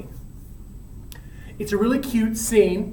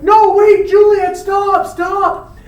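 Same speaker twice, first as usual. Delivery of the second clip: calling after her; 11 semitones higher, 10 dB louder, much slower than usual. Acted. Romeo calls after fleeing Juliet.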